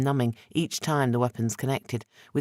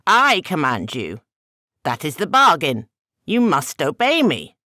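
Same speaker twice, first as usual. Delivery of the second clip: louder and higher, furious command; commanding shout; 4 semitones higher, 8 dB louder than usual. Acted. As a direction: bark the order, rising to a shout. Masked man demands obedience.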